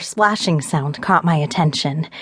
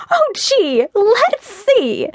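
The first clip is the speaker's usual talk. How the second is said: sarcastic; much slower than usual. Acted. Sarcastic heroine.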